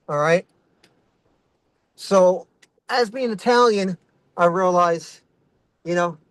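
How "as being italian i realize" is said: ordinary talk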